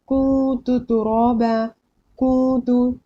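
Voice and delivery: कुतु with a long nasal first vowel, तुराबा slow; ikhfa recitation. Shown as a demonstration. Teacher reading first example, noon hidden in nose. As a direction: hold कुतु in the nose before the second syllable. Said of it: "नाक में छुपा के".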